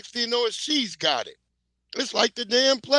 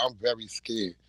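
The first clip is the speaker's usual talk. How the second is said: put-on softer, lower, timid boyfriend voice; mock scared whisper-soft; 10 semitones lower, 6 dB quieter than usual. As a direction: play the scared boyfriend, hushed. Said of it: "He's like".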